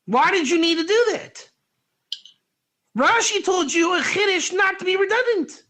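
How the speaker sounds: bursting with excitement over the insight; excited shout. Real, not acted. Teacher to his study partner about Rashi, excited shout. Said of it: "You heard my excitement when I read it".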